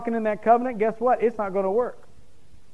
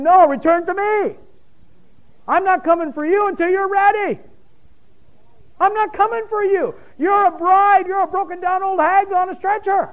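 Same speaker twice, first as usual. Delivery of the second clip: God's rebuke; 8 semitones higher than usual. Retold or imitated. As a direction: cry out as God, stern and urgent. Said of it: "God's up there saying".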